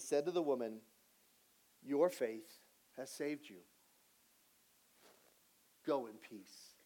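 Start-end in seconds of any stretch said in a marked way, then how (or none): 1.8-6.7 slow, hushed, lower-pitched pronouncement; calm, tender blessing; gentle assurance. Acted. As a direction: let each phrase settle softly, with long pauses. Jesus cutting through the noise, gently.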